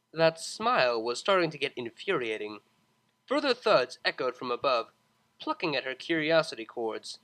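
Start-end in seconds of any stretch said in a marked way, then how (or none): none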